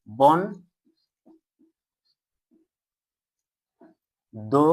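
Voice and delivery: "বন", very long pause, "দো"; syllable by syllable. Shown as a demonstration. Teacher telling pupils to pronounce with least effort, "বন" and "দো" far apart. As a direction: leave a wide gap after each of "বন" and "দো". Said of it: "উচ্চারণ করো স্বল্পতম প্রয়াসে".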